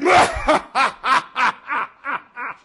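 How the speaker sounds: long, loud staccato belly laugh; booming god laugh. Acted. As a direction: laugh like a giant sky god. Sky god laughs at tiny visitor.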